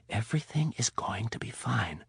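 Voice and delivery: soft whispered reassurance. Acted. Rapp soothing wounded Donatella in a whisper. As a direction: whisper it close to her face. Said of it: "he whispered".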